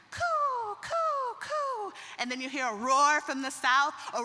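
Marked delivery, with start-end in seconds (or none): 0.1-1.9 high, cooing pigeon call. Acted. Pigeon calls other pigeons.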